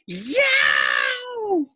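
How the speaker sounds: shrill high yelp of pain; sudden piercing cry; scream. Acted. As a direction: yelp it out, stung and shocked. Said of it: "screamed Leslie".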